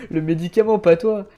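loud, high, laughing; laughing loudly. Real, not acted. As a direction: blurt it out through laughter. Laughs at the misunderstanding.

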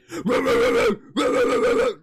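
loud, high snarling dog noises; imitated dog attack. Streamer imitates meme pit bull attacking, loud dog noises. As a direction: savage mock pit bull barking by mouth.

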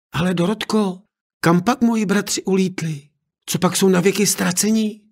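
put-on a worried young princess asking her nurse; young girl asking. Girl asks old maid.

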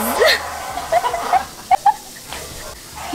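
shrill burst of laughter; high squealing laugh. Real, not acted. Laughing at noisy family.

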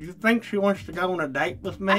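dentures slipping in mouth; talking with fake teeth. Real, not acted. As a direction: lips stretched over prosthetic teeth. Seen 2: Both wear pink-gummed fake teeth; left one adjusts them at mouth.

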